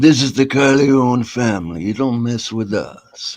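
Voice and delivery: low, slow mumbled menace; Godfather impression. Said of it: "The Godfather".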